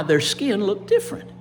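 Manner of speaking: usual speaking voice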